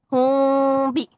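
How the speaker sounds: ہوں بھی stretched slowly, meem hidden with nasal hum; teacher demonstrates ikhfa with a paper gap; paper-thin lip gap. Shown as a demonstration. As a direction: say ہوں بھی with a tiny gap between the lips. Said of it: "اتنا minor سا gap".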